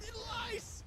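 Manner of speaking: high, slow tattletale cry; high cartoon animal voice. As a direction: squeal out the accusation. Sheep accuses back.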